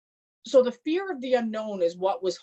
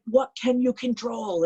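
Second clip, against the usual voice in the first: slow, higher stern question again pressed; retold coach yelling; 5 semitones higher, slower than usual. Coach yelling in her face, slow raised demand. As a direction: drive it home slowly as a coach. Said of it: "he's yelling at me in my face".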